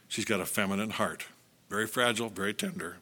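soft and low, gently; tender. Real, not acted. Preacher tells husbands their wives are fragile, softly and tenderly. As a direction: ease down tenderly.

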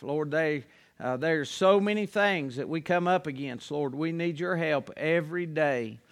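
soft, low pulpit prayer. Acted. Preacher praying over the evening service, soft and low. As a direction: pray quietly and earnestly to the Lord.

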